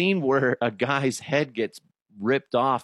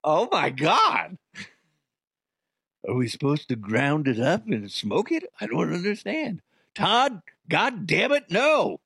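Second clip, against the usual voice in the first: baffled stoner; 4 semitones higher than usual. Retold or imitated. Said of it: "They're like".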